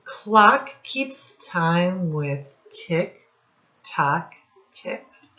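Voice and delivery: lively read-aloud, sound words popped out; reading to children. Acted. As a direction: read it to a class of little kids, playing up the noises. Teacher reads rhyming picture book.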